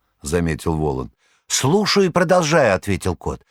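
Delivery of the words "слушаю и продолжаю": high, fussy tomcat voice pleading his case; a boastful cat protesting his heroics, high; cat Behemoth. A narrator's reading.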